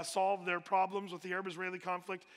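ordinary talk, nothing marked

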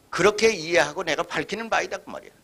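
ordinary talk, nothing marked

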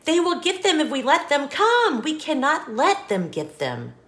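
put-on bouncy storybook reading for a child; lively read-aloud. Parent reading an apple-stacking book to a child, sing-song. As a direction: read it like a bedtime picture book, rhythmic and bright.

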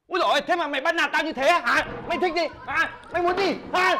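angry outburst. Acted. Angry confrontation with friend.

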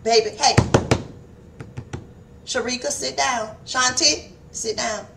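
softly imitating a mother's gentle call; mother nicely calling children to sit; nice soft mama. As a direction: coax the kids down quietly. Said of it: "we do it so nicely".

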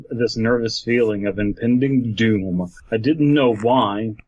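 usual speaking voice

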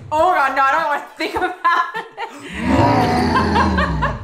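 loud, laughing no way, slowing down; half-laughing dread of the pain; horrified laugh. Real, not acted. Mother recalling labour without epidural, loud horrified laugh.

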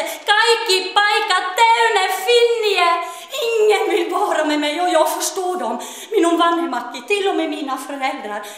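high, loud, sing-song pleading; Finnish-Swedish girl begs God to cure her skin; tearful begging. Acted. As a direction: beg through tears for help. Desperate girl begs for a miracle.

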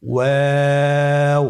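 ওয়াও held long and thin; slow held recitation of ওয়াও; long drawn vowel. Shown as a demonstration. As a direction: stretch ওয়াও over three alifs, slowly. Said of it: "আমার সাথে বলুন".

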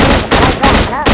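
loud pleading shout to break up a fight; shouting stop. Real, not acted. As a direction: yell at them to quit it. يفض خناقة بين لاعبين ويزعق. (زعيق عالي يفض الخناقة)

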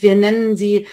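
ordinary talk

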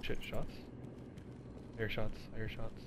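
quiet tense hush. Real, not acted. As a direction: say it under the breath. Hearing enemy fire.

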